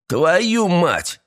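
high-pitched jeering cry; gleeful exclamation. A narrator's reading. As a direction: exclaim high, cruelly amused. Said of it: "расхохотался Эстебан".

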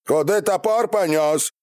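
high, yelled interrogation; breaking into shouting. A narrator's reading. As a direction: lose patience and yell the question. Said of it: "снова срываясь на крик". (срываясь на крик)

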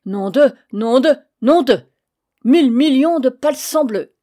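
roaring Breton oath, fists ready; furious swearing. Acted. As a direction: bellow the curse in a rage.

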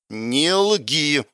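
тихо и холодно, сквозь зубы; процеди с упрёком; процедила сквозь зубы. Acted. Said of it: "процедила она в трубку". (холодно сквозь зубы)

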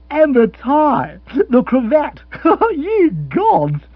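high, loud, slow appalled gasp; mock horror. Rory aghast at Bill's crimson tie, laughing.

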